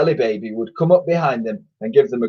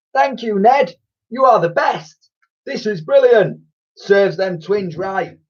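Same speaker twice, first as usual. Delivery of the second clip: put-on much higher girl voice; grateful girl; 9 semitones higher than usual. A narrator's reading. Frizzy-haired girl thanking Ned, high and gleeful. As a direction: thank him with glee. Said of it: "frizzy-haired girl thanks Ned".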